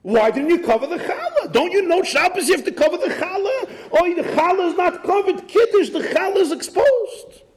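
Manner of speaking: put-on high, laughing Yiddish-accented rant. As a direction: scold in a shrill Yiddish accent. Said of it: "when people start screaming and hollering".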